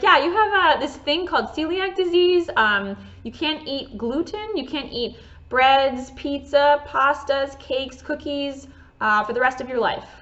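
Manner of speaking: a hint of a matter-of-fact doctor listing foods; doctor explaining. Doctor telling her the foods she can't eat, lightly voiced doctor.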